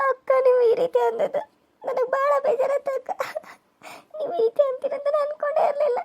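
high, soft tearful sobbing speech; crying. Acted. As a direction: weep through every word. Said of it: "ಎದಕ್ ಅಳಾಕತ್ತಿದಿ?".

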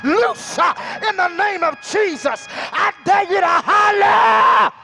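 ordinary talk

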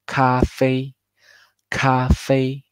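Café held high and level like a first tone. Shown as a demonstration. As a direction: keep café flat and high. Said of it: "El tono sigue pleno y muy alto".

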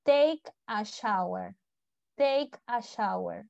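slow "take a shower", TEYK a SHAU-er; pronunciation model. Shown as a demonstration. Teacher has students practise, slowly modelling "take a shower". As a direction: draw out each word of "take a shower". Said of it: "Practiquen eso".

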